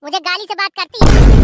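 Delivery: loud indignant yell; angry shout. Acted. Wife yelling at husband about his mother's abuse. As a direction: throw it back at him hard.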